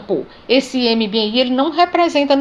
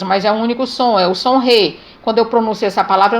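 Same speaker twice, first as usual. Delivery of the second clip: ordinary talk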